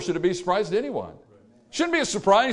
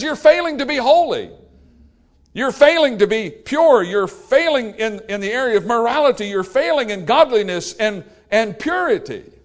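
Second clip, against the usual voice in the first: stern accusation; 5 semitones higher, 5 dB louder than usual. Acted. God accusing his people.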